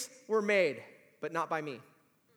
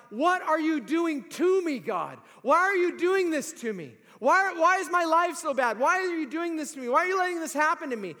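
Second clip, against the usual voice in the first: high, loud anguished plea; complaining to God; 10 semitones higher, 7 dB louder than usual. Retold or imitated. Believer crying out to God, high and loud in anguish. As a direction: cry out at God as if wronged. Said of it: "sometimes we say to God".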